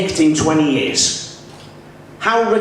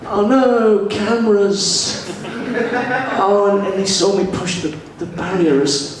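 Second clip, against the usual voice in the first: higher-pitched comic alarm; mock dismay; 7 semitones higher than usual. Car thief telling his mate about the cameras, mock dismay. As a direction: play a hapless thief who's been caught out.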